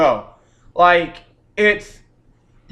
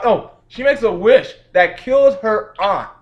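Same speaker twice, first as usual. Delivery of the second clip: ordinary delivery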